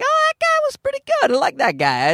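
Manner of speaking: high, thin put-on voice; mock audience member. Showgoer after the comedian's set, high mock approval. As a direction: pitch it way up, casually approving.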